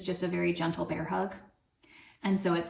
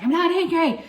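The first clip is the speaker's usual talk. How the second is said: loud, high imitation of an angry child; mock angry denial; 10 semitones higher, 8 dB louder than usual. Daughter insisting to mom she's not upset, mock angry protest. Acting out daughter denying her anger.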